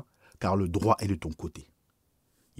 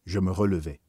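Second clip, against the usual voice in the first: ordinary delivery, nothing marked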